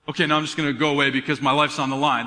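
low, quick, casual aside; mock nonchalance, dropping low and hurrying through; offhand retreat. Voicing absurd casual retreat after miracle.

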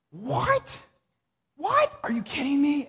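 stunned, voice leaping up on each question; retold disbelief. Pastor hearing of their twenty years of prayer, incredulous.

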